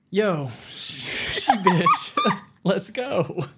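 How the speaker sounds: high and laughing through the words; laughing, slow, the quote pitched high. Retold or imitated. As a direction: giggle through the line. Quoting a line while laughing.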